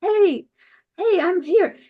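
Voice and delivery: high, bright-called fish voice calling out; little fish calling. Acted. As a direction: call up to the king like a tiny fish. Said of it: "talking to him like".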